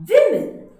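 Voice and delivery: women high and loud, WIM-in; emphatic plural. Shown as a demonstration. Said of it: "the plural is being pronounced as".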